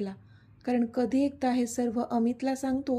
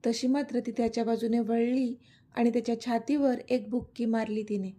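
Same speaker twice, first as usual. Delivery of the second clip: ordinary delivery, nothing marked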